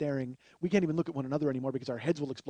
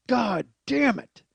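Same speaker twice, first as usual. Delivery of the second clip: slow, loud, raised curse; frustrated curse; 6 semitones higher, 6 dB louder, much slower than usual. Retold or imitated. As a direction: drag out each word in exasperation. Frustration over losing.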